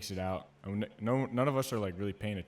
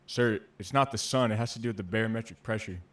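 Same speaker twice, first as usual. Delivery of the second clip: recruit correcting; 8 dB louder than usual. Retold or imitated. Recruit down the line correcting the drill instructor, loud mock-earnest. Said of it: "other recruit, like, down the line was like".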